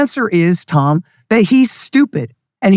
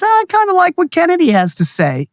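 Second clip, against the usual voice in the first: mock Joe Rogan; 9 semitones higher than usual. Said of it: "Joe Rogan goes".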